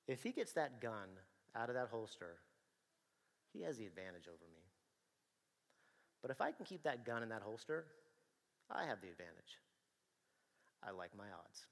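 soft, falling inner thought; sizing up the fight in his head, quietly. Retold or imitated. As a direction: think it through calmly to yourself. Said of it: "this is what I said in my head".

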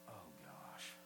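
very low, soft mock groan; guilty dismay. Congregation feeling guilty about their extra stuff, imitated low dismay. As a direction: sink into a hushed, guilty murmur. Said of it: "people are, like, feeling really guilty".

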